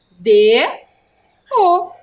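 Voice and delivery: "D, O" as two separate loud letters; letter-by-letter spelling. Shown as a demonstration. Teacher showing how to make do, "D, O" spelled apart. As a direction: spell out "D, O" firmly with a gap between.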